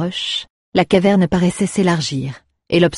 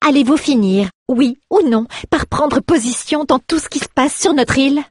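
high, loud, exasperated demand; a daughter losing patience, pitch climbing; 9 semitones higher, 4 dB louder than usual. Acted. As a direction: push him for an answer, fed up. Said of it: "d'un ton exaspéré".

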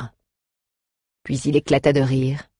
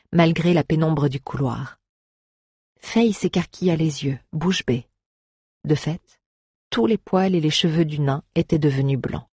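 ordinary talk

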